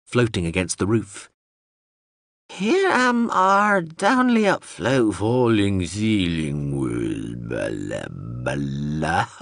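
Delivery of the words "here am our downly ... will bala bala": slow, strange, singsong machine babble; a confused robot drone rambling; babbling drone voice. Acted.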